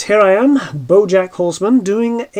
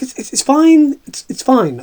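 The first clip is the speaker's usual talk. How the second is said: flustered reassuring; 9 semitones higher, 5 dB louder, slower than usual. Retold or imitated. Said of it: "And I'm like".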